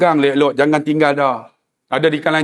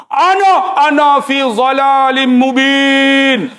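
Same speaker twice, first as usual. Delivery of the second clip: mock Quranic recitation; 7 semitones higher, much slower than usual. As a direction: recite it slowly like scripture. Reciting Quran verse to policeman.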